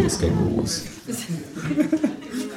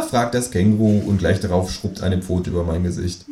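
ordinary delivery

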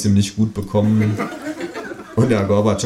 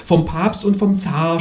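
groaning; 9 semitones higher than usual. A narrator's reading. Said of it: "stöhnt das Gespenst".

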